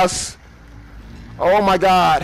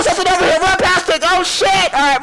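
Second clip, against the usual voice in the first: ordinary talk, nothing marked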